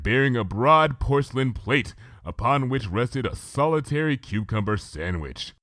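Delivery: plain reading voice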